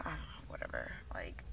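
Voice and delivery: breathy dismissive whisper; whispered aside. Real, not acted. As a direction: drop to a whisper, shrugging it off. Dismissive aside about eating less.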